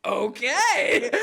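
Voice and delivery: high, loud, laughing okay; thrilled and cracking up; excited reaction. Retold or imitated. Tourists answering the bartender, loudly delighted.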